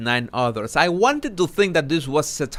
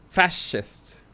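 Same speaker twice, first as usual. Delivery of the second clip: ordinary talk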